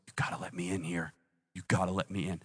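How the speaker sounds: hushed, low tender plea; almost whisper it, lovingly; soft, intimate urging; gentle, loving. Acted. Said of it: "he's so gentle. He's so loving".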